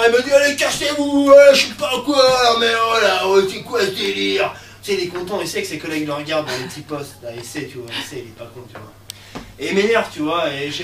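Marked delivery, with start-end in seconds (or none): 0.3-4.5 imitated loud, high, drawn-out rant; mock an irate security guard; angry guard. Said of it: "Mais c'est vénère".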